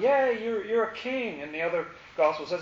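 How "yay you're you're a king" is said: mock crowd cheer. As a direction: hail him like a fan in the crowd. Crowd cheering Jesus' entry.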